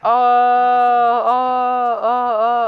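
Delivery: loud, high hyped shouts; excited yelling. Real, not acted. Streamer yelling at chat in hype. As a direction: yell at chat in sheer hype. Hyped reaction to game moment.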